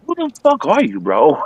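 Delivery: a light bewildered voice; imitating the bar manager. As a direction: play the stunned boss asking who you are. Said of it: "and the guy goes".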